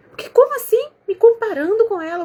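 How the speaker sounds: mock offended, voice jumping way up; indignant mother. The upset mother answering the therapist, high indignant outburst.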